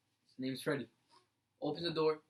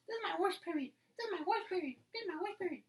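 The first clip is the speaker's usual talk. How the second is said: high, repeated teacher impression; teen mimicking a teacher's complaint, high mock voice; 13 semitones higher, 5 dB quieter than usual. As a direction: parrot the teacher in a much higher put-on voice. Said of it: "Making that voice".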